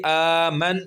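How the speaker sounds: آمن with the ن closed and no vowel after; slow sakin ending. Shown as a demonstration. Teacher giving an example of waqf, slow. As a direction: say آمن slowly, end on a bare ن. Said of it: "ہم اس حرف کو ساکن کر دیں گے".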